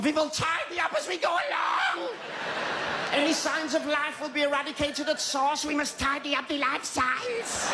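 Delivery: high, ranting tyrant proclamation; hammed-up fascist leader voice; mock dictator. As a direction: bark it like a dictator addressing a rally, slowing at the end. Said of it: "this Nazi philosophy".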